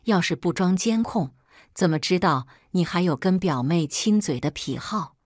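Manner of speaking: ordinary delivery, nothing marked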